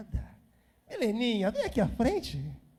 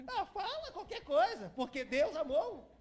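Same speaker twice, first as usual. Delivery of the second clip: laughing tease; 6 semitones higher than usual. Real, not acted. Teasing daughter to speak English.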